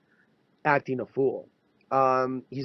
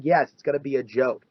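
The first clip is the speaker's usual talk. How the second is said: ordinary talk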